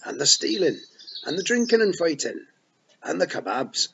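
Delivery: high, loud Scots pixie, full of pride; excited Feagle boast. A narrator's reading. Rob boasts to Tiffany about being born here, loud and proud. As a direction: wee warrior proudly telling his tale.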